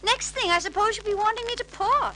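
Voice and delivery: high, resigned prim tone; weary nanny sigh. A narrator's reading. Mary Poppins resigned to pouring tea, weary. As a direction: say it with a put-upon sigh. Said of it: "said Mary Poppins with a sigh".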